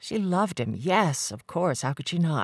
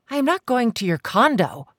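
insisting; 9 semitones higher, 5 dB louder than usual. Acted. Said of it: "she insisted".

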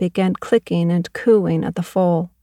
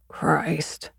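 weary whisper; 2 semitones lower, 7 dB quieter than usual. Acted. Father weary of visitor's fuss.

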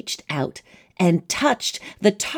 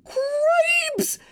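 very high shrieking mouse voice; squeaky mouse shout. Acted. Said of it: "shouted Furlow again".

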